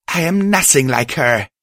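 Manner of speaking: high, loud indignant shout. Acted. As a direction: fling back the insult loudly. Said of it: "Spark shouted".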